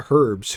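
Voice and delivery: "Herbs" with a sounded H; H pronounced. Retold or imitated. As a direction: repeat "Herbs" with the H audible. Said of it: "Who pronounces the H in herbs?".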